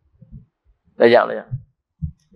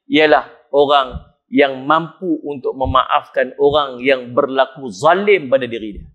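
usual speaking voice

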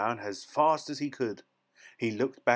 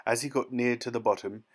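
usual speaking voice

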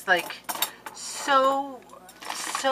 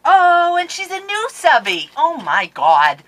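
loud, higher excited cry; thrilled; 12 semitones higher, 14 dB louder than usual. Real, not acted. Vlogger thrilled a new subscriber sent gifts, loud. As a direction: burst out with joy.

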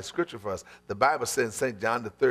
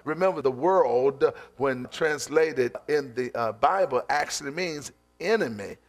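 ordinary talk, nothing marked

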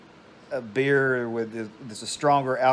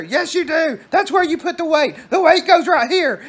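very high, loud mock scream; 14 semitones higher, 6 dB louder than usual. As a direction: imitate a mother screeching back in an argument. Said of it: "she would just be screaming".

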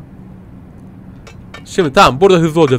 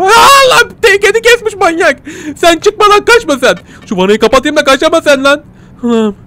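high, loud terrified shrieks; hammed-up fright at the killer; high frantic yelling; mock horrified panic; 11 semitones higher, 4 dB louder than usual. Horrified by cat-killer cellmate.

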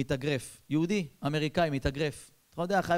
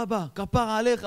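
acted higher-pitched delight; gushing enthusiasm; 5 semitones higher, 3 dB louder than usual. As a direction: greet dad with drawn-out, eager affection. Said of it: "מה אתה מתלהב?".

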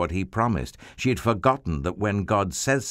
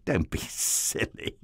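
giggling to himself; 3 dB quieter than usual. Acted. Abraham dismissing the idea.

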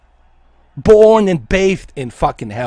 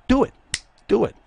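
ordinary talk, nothing marked

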